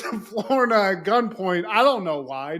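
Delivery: ordinary talk, nothing marked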